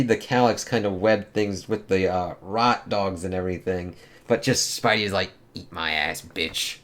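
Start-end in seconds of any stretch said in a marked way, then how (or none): 5.5-6.8 low, soft, half-whispered insult; muttered taunt. Acted. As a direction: sneer it under your breath. Spidey taunts villain.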